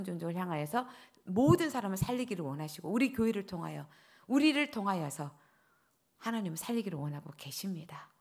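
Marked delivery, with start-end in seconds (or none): 6.2-8.1 whisper. Real, not acted. Preacher drops to whisper.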